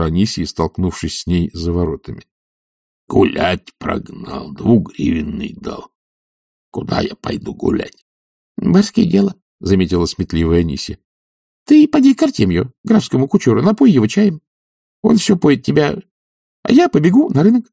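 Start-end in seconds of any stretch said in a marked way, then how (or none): 3.1-8.0 very slow, husky complaint; hoarse old retainer grumbling; baffled servant. A narrator's reading. Zakhar complains to Anisya. 8.6-9.3 high-pitched servant woman; shrewd maid. A narrator's reading. Maid Anisya's character voice. 11.7-17.7 high, lively servant woman; high-voiced female character; shrewd maid. A narrator's reading. Maid Anisya advises Zakhar.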